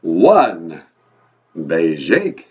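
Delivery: low, loud vampire count. Acted. Count says the English numbers for the host, booming vampire voice. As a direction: announce the number like a theatrical vampire.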